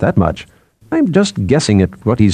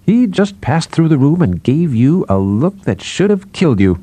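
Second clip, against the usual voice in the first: higher, lilting teasing delivery; amused, near laughing; 7 semitones higher than usual. A narrator's reading. Psychologist teases colleague about a killing look, mildly amused. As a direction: tease with lifted, bouncy pitch.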